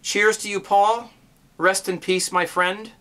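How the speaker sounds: loud, high heartfelt toast to a friend. Real, not acted. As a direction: call it out like raising a glass. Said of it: "finish this video off saying".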